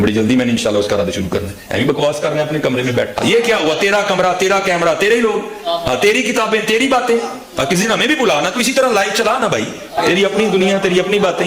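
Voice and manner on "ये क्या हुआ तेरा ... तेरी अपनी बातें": mocking taunt. Mocking the rival's live stream.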